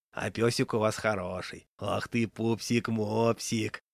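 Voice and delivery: slow into a fond coo; cooing at dog. A narrator's reading. Raincoat man petting the dog, cooing.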